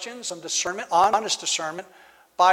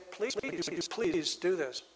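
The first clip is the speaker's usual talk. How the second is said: quiet repeated please, falling away; soft pleading; 11 dB quieter, much slower than usual. Retold or imitated. Believer softly pleading with God in prayer. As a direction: beg softly, almost under the breath. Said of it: "and say".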